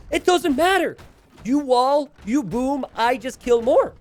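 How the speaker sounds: high, loud boastful shouting; hammed-up shouted war logic; mock battle bravado. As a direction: play the reckless attacker, full volume. Voicing the aggressive player's reckless logic.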